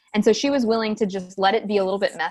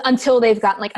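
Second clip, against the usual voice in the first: ordinary delivery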